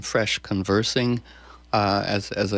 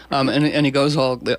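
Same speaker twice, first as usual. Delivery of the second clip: ordinary talk, nothing marked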